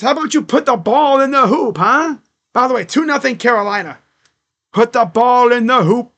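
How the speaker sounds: loud, excited basketball commentary; play-by-play. Acted. Streamer calls the Timberwolves game live, loud play-by-play. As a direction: call the game to the chat with energy.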